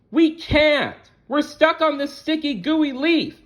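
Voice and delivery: very high tiny ladybug voice; Haley stuck. Acted. Haley telling Wormy in a high ladybug voice. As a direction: squeak it out like a stuck little bug. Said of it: "said Haley".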